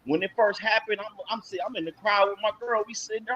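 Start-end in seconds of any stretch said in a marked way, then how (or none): none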